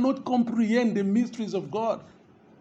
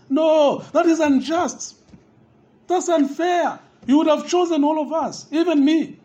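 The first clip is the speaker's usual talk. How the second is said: higher, protesting voice of a complaining listener; indignant objector; 5 semitones higher, 4 dB louder than usual. Acted. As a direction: play the offended doubter crying unfair. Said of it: "people would ask that question".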